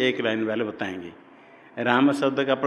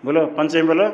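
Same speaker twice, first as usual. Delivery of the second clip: ordinary talk, nothing marked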